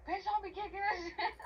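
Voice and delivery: high-pitched mock outrage, laughing through it; retold losing it. Retelling the kicked mattress argument, laughing mock outburst. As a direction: snap back in high, amused outrage. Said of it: "lost it again".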